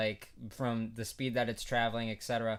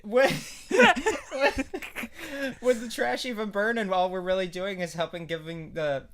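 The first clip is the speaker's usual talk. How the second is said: high squeaky laughing question; 6 semitones higher, 4 dB louder than usual. Real, not acted. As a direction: push the words out through laughter.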